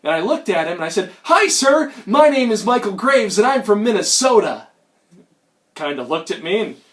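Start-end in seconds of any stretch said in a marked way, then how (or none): 1.2-4.7 higher, chipper greeting; eager introduction. Retold or imitated. Visitor greeting an old street hustler, eager and polite. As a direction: introduce yourself with naive eagerness.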